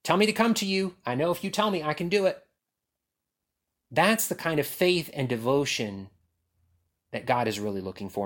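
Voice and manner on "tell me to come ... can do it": eager Peter. Retold or imitated. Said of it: "the heart that Peter had".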